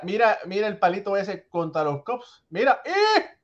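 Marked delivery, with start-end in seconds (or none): 2.5-3.4 high, loud home run catchphrase; broadcaster catchphrase. Retold or imitated. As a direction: shout it like a TV announcer's signature call. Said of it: "Como dice nuestro querido amigo". (grito de narrador de béisbol)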